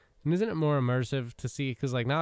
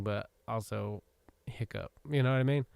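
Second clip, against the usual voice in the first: usual speaking voice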